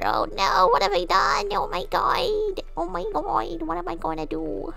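hammed-up "I'm doomed" whine; mock panic. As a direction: wail in pretend horror. Mock panic over clip going to Twitter.

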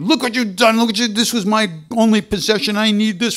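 shrieking outrage at the lost possession; furious yelling. Retold or imitated. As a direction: yell at him over the broken pot. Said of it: "and he starts yelling".

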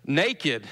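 put-on "naked" said carefully as NAY-kid; "naked" set apart, carefully without a southern accent. Shown as a demonstration. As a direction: avoid the southern drawl on "naked". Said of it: "without the southern accent".

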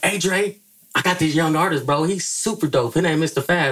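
imitating his pitch. Editor pitching [name] to [name], high hyped voice. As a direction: sell the young artist eagerly. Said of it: "I'm like".

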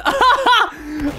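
high-pitched delighted cackle; sudden squealing laugh; high burst of laughter. Real, not acted. Amused by the confusion.

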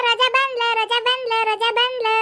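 high, sing-song call announcing her arrival. Acted. Announcing to the family that Roja has come, calling out high. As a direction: call it out to the whole house, pitched high.

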